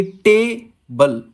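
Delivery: Table slowly, weight on टे; stressed टे, then unstressed बल; stress on first syllable. Shown as a demonstration. Teacher giving a trochee example, stress on टे in table. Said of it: "बड़े के, अ, अक्षरों में लिखा है".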